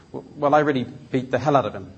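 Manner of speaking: ordinary talk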